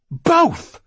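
shrieked, very high and loud; furious scream. Acted. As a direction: scream it at him. Woman screams back in a fight.

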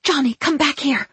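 high, sharp call. A narrator's reading. Mother calling her runaway boy, sharply. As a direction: call the child back sharply. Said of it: "And then sharply".

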